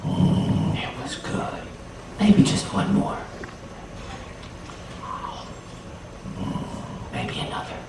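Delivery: hushed, greedy little murmur; savoring. Acted. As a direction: murmur it softly between tastes. Anansi savoring a secret taste.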